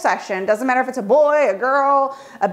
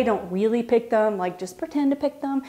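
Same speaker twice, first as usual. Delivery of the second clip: much softer, hushed reassurance; gentle hush to a kid; reassuring softly; 5 dB quieter than usual. Retold or imitated. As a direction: soothe a worried child quietly.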